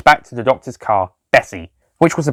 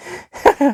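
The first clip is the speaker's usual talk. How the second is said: very high short giggle; laugh. A narrator's reading. Lisa joining the Doctor's laughter, high giggle. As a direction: join in the laughter. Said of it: "Lisa started to join in".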